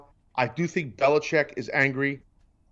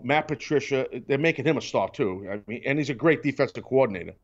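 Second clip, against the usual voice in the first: ordinary talk, nothing marked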